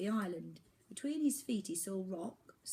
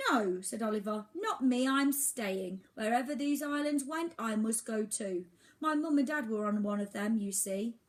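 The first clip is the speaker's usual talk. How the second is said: higher, louder stubborn refusal; determined boy; 4 semitones higher, 6 dB louder than usual. Acted. Oliver refusing to leave the island, firm higher voice. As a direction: stand your ground.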